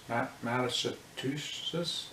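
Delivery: Massachusetts said very slowly, stumbling on Ma-; struggling to say it. Shown as a demonstration. Vlogger fumbling a hard state name, slow and halting. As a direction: drag out Massachusetts, syllable by syllable, after a false start. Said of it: "I can't even say that state".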